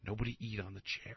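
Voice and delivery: a hint of a stern grandma, barely above a breath; imitating grandma. Grandma warns family off new chair, soft hushed mock order. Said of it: "Grandma was like".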